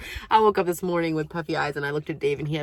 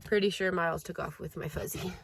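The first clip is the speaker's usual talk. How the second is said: ordinary delivery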